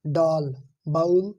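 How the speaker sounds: डाल and बाउल drawn out slowly side by side; slow contrast drill. Shown as a demonstration. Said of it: "अब आप इन्हें पढ़ के देखते हैं".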